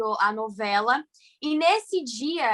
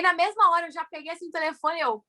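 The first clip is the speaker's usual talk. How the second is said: normal talking voice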